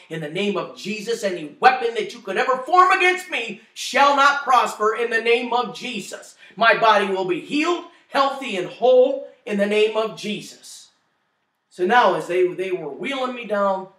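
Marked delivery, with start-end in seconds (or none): 0.1-10.9 forceful declaration in Jesus' name, louder and higher; rebuking Satan out loud, commanding; loud rebuke. Retold or imitated. Speaker recalls rebuking Satan, loud and commanding.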